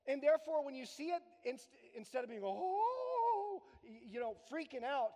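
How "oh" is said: high, louder shocked cry; mock shocked gasp. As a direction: play the shock big. Acting shocked reaction.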